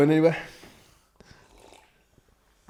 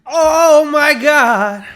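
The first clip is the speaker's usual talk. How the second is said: burning groan; 11 semitones higher, 7 dB louder, much slower than usual. Real, not acted. Still burning from chip.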